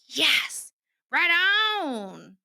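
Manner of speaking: a loud "Yes!" then an upbeat "Right on!"; excited cheer. Retold or imitated. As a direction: cheer him on with joy. Cheering the husband's decision.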